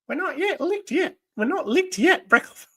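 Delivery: high-pitched put-on villainess voice; Baroness voice. Defying Breckhoff from a comic, high Baroness voice. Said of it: "my Baronet voice".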